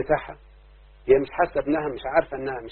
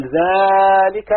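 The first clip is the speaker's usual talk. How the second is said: ذلك drawn out very slowly, higher and louder, the lam held; stretched lam; 5 semitones higher, 5 dB louder, much slower than usual. Shown as a demonstration. Teacher shows pupils the far pointing ذلك, slow and loud. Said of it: "لو جاب اللام قال".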